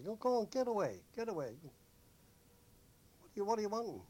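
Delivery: high, loud get-away; shooing them off. Retold or imitated. Sailors pushing away street hawkers, exasperated shooing. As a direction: wave off pests, exasperated.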